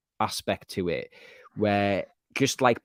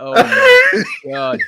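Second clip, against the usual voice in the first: very high, loud, stretched-out cry; hammed-up high shriek of shock; mock shocked outcry; 12 dB louder, much slower than usual. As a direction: wail it slowly in comic horror. Mimics shocked reaction to scene.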